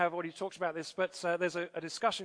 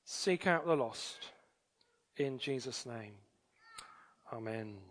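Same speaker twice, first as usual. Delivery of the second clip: public prayer; 3 semitones lower than usual. Real, not acted. Leads the congregation in prayer.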